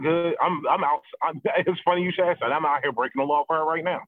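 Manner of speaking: normal talking voice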